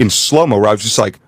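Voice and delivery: ordinary talk, nothing marked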